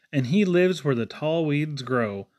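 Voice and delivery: ordinary delivery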